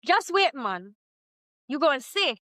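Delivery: put-on light Jamaican accent. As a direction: say it with a hint of Jamaican. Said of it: "in my Jamaican accent".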